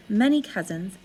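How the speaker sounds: ordinary delivery, nothing marked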